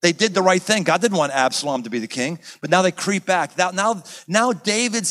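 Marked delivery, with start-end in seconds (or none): none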